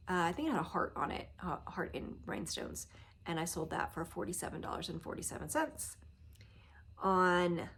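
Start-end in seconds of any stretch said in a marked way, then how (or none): none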